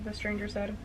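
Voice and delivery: ordinary delivery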